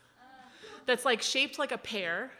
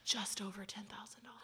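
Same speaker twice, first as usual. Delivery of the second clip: soft unvoiced whispered reveal; hushed confession of cost; 14 dB quieter than usual. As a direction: whisper the price proudly. Host reveals the torture device price.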